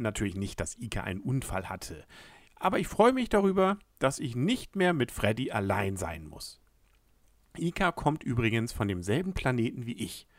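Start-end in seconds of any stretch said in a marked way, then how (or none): none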